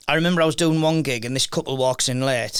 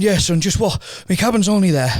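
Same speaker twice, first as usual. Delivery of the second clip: voicing the breathless old man, louder; a hint of a wheezy old bloke, raised; tired old man on the ship, voiced a bit louder; 5 semitones higher, 5 dB louder than usual. Old man voiced in cruise story.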